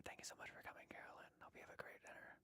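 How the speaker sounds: soft whispered farewell; whispering. Pretend. Streamer thanking departing birthday viewer in an ASMR whisper. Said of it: "did a whole live stream like that".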